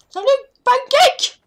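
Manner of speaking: high squeaky greeting voice; silly high voice. Pretend. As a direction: chirp it in a cartoon voice. Greeting a game object in silly voice.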